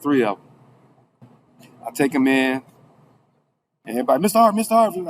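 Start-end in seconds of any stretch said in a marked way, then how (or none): 4.2-5.1 high, quick call for attention. Retold or imitated. Inmates calling to the man with trays, high calling out. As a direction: imitate folks calling after him. Voices crowd calling to him.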